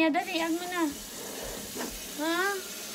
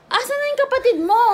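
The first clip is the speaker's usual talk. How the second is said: high worried question to the son; urgent, anxious asking; worried call; 11 semitones higher, 5 dB louder than usual. Real, not acted. Asking son where baby went. (nag-aalalang tanong)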